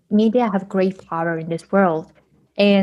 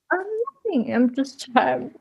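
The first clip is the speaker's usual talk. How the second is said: retold teary embarrassment; 6 semitones higher than usual. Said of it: "and I'm crying".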